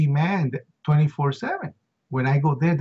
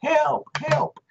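much higher, desperate cries of help; imitated screams for help; 13 semitones higher, much slower than usual. Men trapped in a flooding elevator, high desperate cries. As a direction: echo the trapped men's cries, high and far. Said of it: "Two people screaming".

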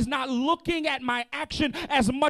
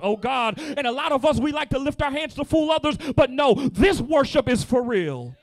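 louder, rising preacher's cadence; fervent preaching; 2 semitones higher, 5 dB louder than usual. Acted. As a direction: drive it home to the congregation. Preaching builds in fervor.

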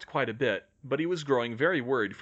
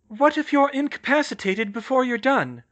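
high, loud anxious question; worried; 12 semitones higher, 7 dB louder than usual. A narrator's reading. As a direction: ask with pitched-up worry. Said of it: "Hermione said worriedly".

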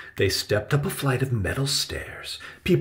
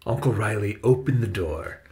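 ordinary delivery

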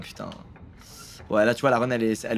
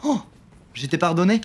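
ordinary talk

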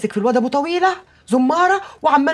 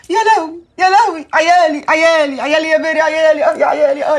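loud wailing lament; 5 dB louder than usual. Acted. Mother wailing to Bery over her children, loud lament. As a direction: wail it like a mother in dread.

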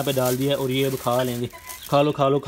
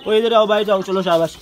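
higher, louder shout to an animal; calling out; 5 semitones higher, 5 dB louder than usual. Real, not acted. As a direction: shout across the yard to bring him over.

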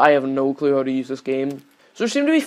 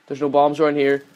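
ordinary talk

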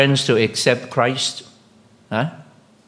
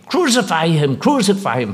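raised mob cry, fading on the repeat; crowd shouting; 6 semitones higher than usual. Retold or imitated. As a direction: call it out like the crowd, then ease off. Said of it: "what are they saying?".

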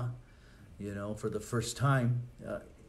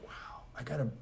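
whisper; 3 semitones higher, 6 dB quieter than usual. Pretend. Inner thought of a believer.

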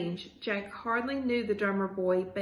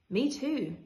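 ordinary delivery, nothing marked

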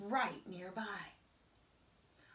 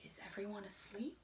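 soft quick whisper; hushed bedtime whisper; 8 dB quieter, faster than usual. A narrator's reading. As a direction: whisper so nobody wakes. Checking the baby sleeps.

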